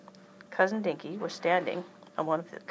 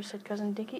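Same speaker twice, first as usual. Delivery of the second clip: ordinary delivery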